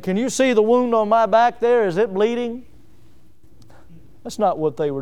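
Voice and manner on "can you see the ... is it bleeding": put-on higher, louder, like a child showing a boo-boo; mock whiny complaint. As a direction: whine for sympathy, hammed-up. Mock whining of Paul and Silas.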